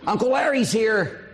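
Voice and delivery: high sing-song call of a sister announcing the uncle. Retold or imitated. Sister calling out arrival.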